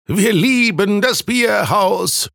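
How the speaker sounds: high, drawn-out tipsy chant; drunken joining in. A narrator's reading. Another reveller joining the redhead, high slow chant. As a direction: chime in slowly on a high pitch. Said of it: "stimmte ein anderer mit ein".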